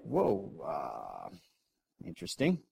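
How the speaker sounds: low, soft mock-unimpressed; deadpan. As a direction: play it cool and unconvinced. [name] playing it cool.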